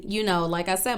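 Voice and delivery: ordinary talk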